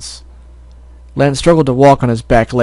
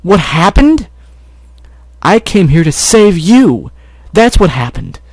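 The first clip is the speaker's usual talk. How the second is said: louder, higher angry shout of the repeated question; the anger boils out, louder and slower; angry outburst; 6 semitones higher, 5 dB louder, slower than usual. Acted. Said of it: "couldn't hold back his anger".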